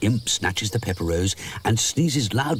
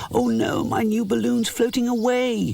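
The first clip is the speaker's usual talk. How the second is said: despair. A narrator's reading. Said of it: "he cries in despair".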